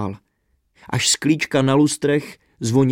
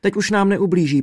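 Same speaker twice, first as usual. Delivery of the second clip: higher, urgent begging; pleading princess; 6 semitones higher, 3 dB louder than usual. A narrator's reading. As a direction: beg him to let her father go. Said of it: "prosila Světluška".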